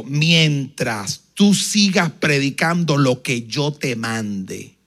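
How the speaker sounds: low, slow, solemn command; the Lord instructing the preacher, solemn. Retold or imitated. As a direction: give the promise like a divine command. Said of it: "Me dijo".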